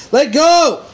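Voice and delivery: shrill yelled chant; high shout. Pretend. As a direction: yell it high.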